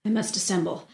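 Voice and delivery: soft, secretive remark to herself; hushed aside. Acted. Lady resolves to hide who she is, hushed aside. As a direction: slip it in under the voice.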